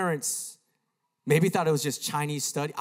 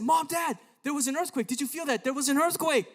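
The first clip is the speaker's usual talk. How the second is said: high-pitched, urgent retold alarm; alarmed calling; 13 semitones higher than usual. Boy telling mom and dad, alarmed. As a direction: call out to be believed, pitch way up.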